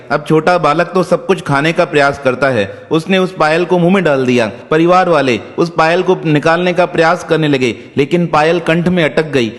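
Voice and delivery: usual speaking voice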